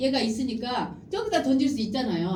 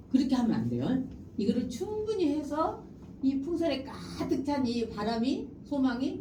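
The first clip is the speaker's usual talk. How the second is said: usual speaking voice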